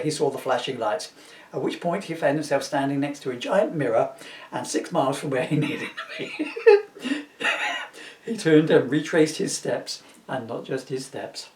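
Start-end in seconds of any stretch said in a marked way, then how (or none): 6.4-7.8 high giggling fit, can't stop; a high squealing laugh breaking through; helpless high laugh. Real, not acted. Reading a silly story to viewers, laughing helplessly.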